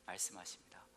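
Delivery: quiet, hushed prayer over music; gentle pastor's prayer with whispered lines; soft closing prayer. Acted. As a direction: draw the room into prayer.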